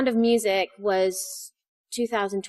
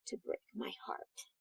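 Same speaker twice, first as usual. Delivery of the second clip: hushed plea; 8 dB quieter than usual. Retold or imitated. Quiet intense note to scene partner.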